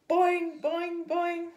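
high, loud, springy boing noises; bouncy boings. Acted. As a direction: bounce each one like a spring. Imitates bouncing on a belly.

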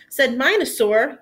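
ordinary delivery

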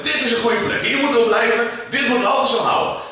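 high, loud eager disciple; eager Peter. Acted. Peter on the mountain with Jesus, eager high call. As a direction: blurt it out like an excited follower. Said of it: "En hij zegt".

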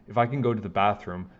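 ordinary delivery

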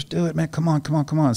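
high, urging imitation of a buddy; mock coaxing. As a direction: wheedle him with repeated urging. Old officer coaxing him to agree.

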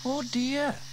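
high sighed oh dear; young girl Sarah sighing. A narrator's reading. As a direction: sigh it like a worried girl. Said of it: "sighed Sarah".

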